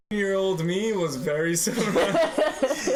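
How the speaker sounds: slow words through laughter; laughing. Real, not acted. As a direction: laugh while telling it.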